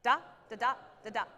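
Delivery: short, spaced da da da; lifted and separate. Shown as a demonstration. The band director shows lifted notes. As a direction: give each da space. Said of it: "Say it with me".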